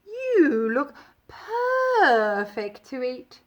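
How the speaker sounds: slow, hungry cat voice. A narrator's reading. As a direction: purr it with a hungry cat's relish. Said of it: "meowed the cat".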